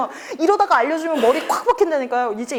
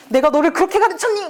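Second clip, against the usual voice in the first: higher, louder mock scold; scolding mother; 5 semitones higher, 5 dB louder than usual. Mother scolding the boy over the stolen flower, mock stern. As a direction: play the shocked mother telling off her child.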